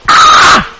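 mock cry of alarm; imitated scream. Imitating how somebody else would react, mock alarm. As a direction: let out a hammed-up gasping scream. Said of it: "somebody else would have gone".

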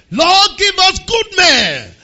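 slow, loud, high prayer close; drawn-out shouted amen. Real, not acted. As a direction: stretch out the closing line as a cry. Leading prayer.

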